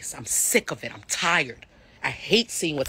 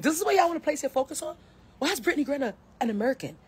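incredulous; 10 semitones higher, faster than usual. Retold or imitated. Incredulous quoted reaction.

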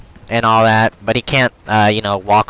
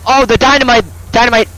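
high, loud panicked shout at the dynamite; panicked yell; 4 dB louder than usual. Real, not acted. Gamer yelling at a dynamite throw, panicked shout. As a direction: yell in alarm as it lands.

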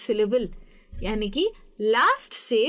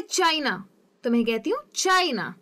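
"China" with CHAI punched, falling NA; demonstrating "China" front-stressed; stress on first syllable; 2 semitones higher than usual. Shown as a demonstration. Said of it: "चाय पे ज़्यादा stress".

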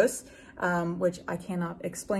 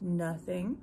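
shy; 2 semitones lower, 8 dB quieter than usual. A narrator's reading. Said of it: "he said shyly".

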